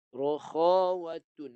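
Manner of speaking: Rokhawatun stretched very slowly; a slow teacher's drawl on Rokhawatun; drawn out. Shown as a demonstration. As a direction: say Rokhawatun slowly to show the sound flowing. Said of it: "sifat dia adalah rokhawatun".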